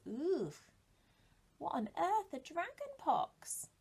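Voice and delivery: ordinary delivery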